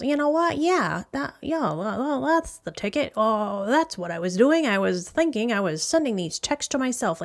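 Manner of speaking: high, squirmy put-on voice caught out; mocking Kendra. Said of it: "twitches her face and goes".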